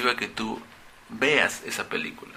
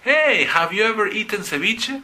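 bright, high, eager question; friendly hey, much higher and louder; lively conversation opener; 10 semitones higher, 4 dB louder than usual. Shown as a demonstration. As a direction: open a chat with someone, all enthusiasm. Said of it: "Porque abre conversaciones".

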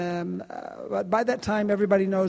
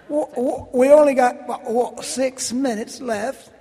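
higher, stumbling fake British accent; bad British accent; 4 semitones higher, 5 dB louder than usual. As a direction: attempt a British accent, badly. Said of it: "That's my really bad accent".

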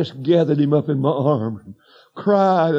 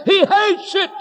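emphatic preacher's cry. Acted. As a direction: fling it at the congregation.

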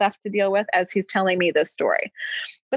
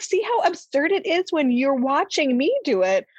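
exasperated parent; 7 semitones higher than usual. Retold or imitated. Parent scolding kids in retold retort.

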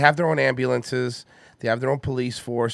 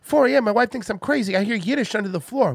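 higher Boston tough-guy voice, worried; a puzzled Boston guy in a tunnel; concerned Mark Wahlberg; 9 semitones higher than usual. Acted. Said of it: "impression of a concerned citizen Mark Wahlberg".

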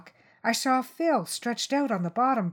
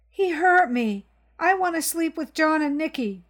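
put-on high, louder child's outburst; upset little boy; 9 semitones higher, 5 dB louder than usual. Boy insists to his mother Phil hurt him, high upset child. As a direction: blurt it out like a hurt kid.

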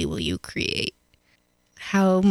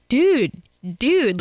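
surfer dude; 4 semitones higher, 5 dB louder than usual. Retold or imitated. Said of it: "she spoke like a surfer".

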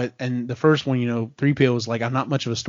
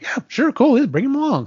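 high, eager little droid voice; high-voiced cartoonish go-along; Dio; 11 semitones higher, 3 dB louder than usual. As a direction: pitch it way up, easygoing and agreeable. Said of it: "Dio was just like".

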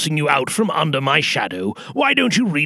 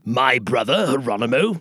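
ordinary talk

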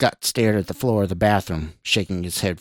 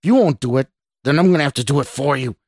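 louder, higher, fed-up outburst; growled; 5 semitones higher, 4 dB louder than usual. A narrator's reading. Stranger threatens to take over from Scott, growling. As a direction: build to an angry threat. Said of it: "the stranger growled".

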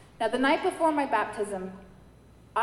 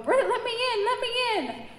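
pleading; 12 semitones higher, 5 dB louder than usual. Retold or imitated. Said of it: "And Jesus is going".

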